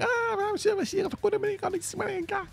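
put-on very high, soft gibberish meant as Spanish kids' chatter; fake Spanish. The kids downstairs answer in squeaky fake Spanish. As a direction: squeak out "I'm sure, I'm sure" as mock Spanish kids. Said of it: "See, that's my Spanish".

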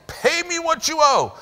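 high, sharp mock demand; hammed-up demand for the money; demanding servant. Servant grabs debtor by throat.

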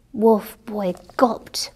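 ordinary delivery, nothing marked

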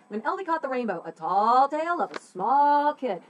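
lightly hammed-up stage announcement; announcing the title. Shown as a demonstration. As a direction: proclaim the title like the colors. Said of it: "so that the people who were playing these characters could do it like that".

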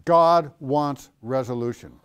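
ordinary delivery

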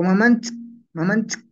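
Mamanchik cut to "ma-MANTS"; shortened ending. Shown as a demonstration. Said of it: "así nomás".

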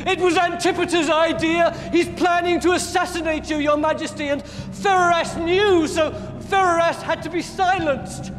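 high-pitched panicked confession; screamed confession. Acted. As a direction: blurt it out high, under torture. Tortured butler screams confession.

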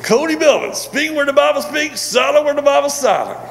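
high-pitched mimicked voice, held up high on nearly every word; Shell Lehman imitation. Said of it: "Shell Lehman walks up to me. He goes".

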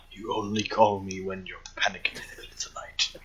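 soft soothing whisper. Acted. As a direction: hush it like calming a friend. Calming a panicked caller.

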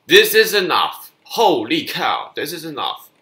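loud, high yell of frustration; furious outburst. Real, not acted. Gamer losing to the enemy, furious outburst. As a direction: shout that you've had enough.

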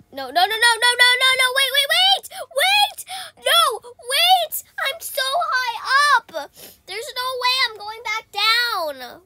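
high, loud frantic cries; shrill-pitched rapid panic, wailing; panicked screaming. Real, not acted. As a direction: plead in terror of losing your height. About to fall from high up.

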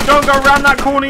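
urgent repeated yell; warning shout. Real, not acted. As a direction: shout it again, insistent.

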